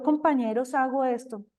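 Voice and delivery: ordinary talk, nothing marked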